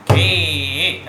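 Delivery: GHE held long and firmly; drawn-out syllable call. Shown as a demonstration. Teacher demonstrates the stroke, syllable drawn out. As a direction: call out a sustained tabla syllable. Calls syllable for pupils to repeat.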